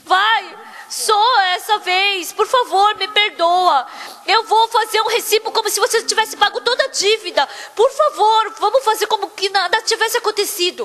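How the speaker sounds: hammed-up tearful begging; nearly crying. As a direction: beg desperately, as if close to tears. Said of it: "Depois ele tava quase chorando".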